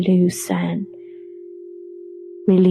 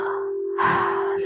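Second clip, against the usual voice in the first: long, soft audible sigh; soft letting-go breath; relaxed exhale; 7 dB quieter than usual. Shown as a demonstration. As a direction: let the breath out slowly in a sigh. Demonstrates the exhale.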